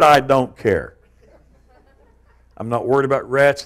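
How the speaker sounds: ordinary talk, nothing marked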